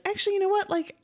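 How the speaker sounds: high-voiced comic relief, laughing at the end; mock realization. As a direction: pitch it way up, breaking into laughter. Narrator's relieved realization, acted with laughter.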